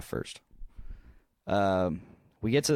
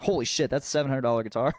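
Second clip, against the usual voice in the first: shocked; 5 semitones higher, 5 dB louder, faster than usual. Retold or imitated. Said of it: "I'm like".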